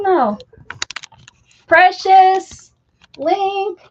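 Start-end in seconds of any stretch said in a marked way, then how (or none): 1.7-3.8 high, loud call across the house; calling out names. Real, not acted. Owner calling her cats loudly by name. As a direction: call the cats so they come.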